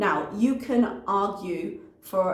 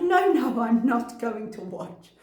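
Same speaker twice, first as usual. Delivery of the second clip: mock adamant refusal; 4 semitones higher, 4 dB louder than usual. Mimics her refusing to watch.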